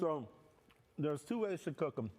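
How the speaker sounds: talking through a mouthful; words round a bite of crawfish; mouth full. Real, not acted. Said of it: "I got a little leg in my mouth".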